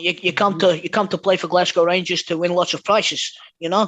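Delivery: put-on Dutch-accented English, playful. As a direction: say it like a Dutch footballer. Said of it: "what an accent that was".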